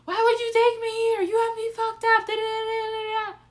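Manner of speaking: high, loud, sing-song outraged imitation; mocking someone who blames their friends, high and loud; mock indignant rant. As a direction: ham up a huffy, offended friend. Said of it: "some people would be in that situation and be like".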